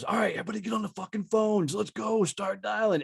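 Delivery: high-pitched fast yell at the sales floor; retold yelling. Sales manager yelling at reps to dial the phones, fast high shout. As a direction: bark orders at the whole room, rushed. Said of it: "starts screaming and yelling people".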